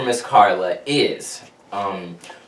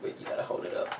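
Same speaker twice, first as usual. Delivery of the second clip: whispering; 15 dB quieter, much faster than usual. Real, not acted. Hushed while viewing the photo.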